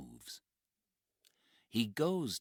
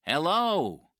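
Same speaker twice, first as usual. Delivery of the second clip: calling out; 12 semitones higher, 13 dB louder than usual. Acted. Calling out to hidden stranger.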